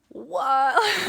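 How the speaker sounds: loud, high, drawn-out disbelief; hammed-up awed outburst; retold amazement. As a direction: exclaim as if stunned by the view. Acts out amazement at the view.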